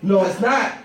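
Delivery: normal talking voice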